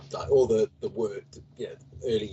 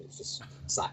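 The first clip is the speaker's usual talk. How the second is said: normal talking voice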